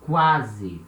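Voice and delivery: quase said "KWA-zee"; Portuguese word. Shown as a demonstration. Teacher modelling quase for learners. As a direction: qu of quase as kw, final e as ee. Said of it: "this word here".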